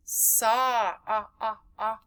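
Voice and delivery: ah repeated as an open o vowel; teacher pulling ah out of sock; short o sound. Shown as a demonstration. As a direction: isolate the o in sock. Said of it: "We hear Oscar Orange".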